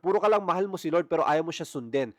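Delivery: normal talking voice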